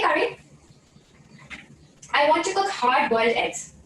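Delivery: normal talking voice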